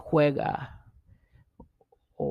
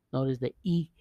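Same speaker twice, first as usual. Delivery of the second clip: ordinary talk, nothing marked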